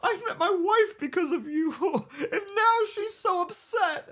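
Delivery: hammed-up high, wailing husband; badly faked sobbing in falsetto; mock crying. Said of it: "why are you crying right now".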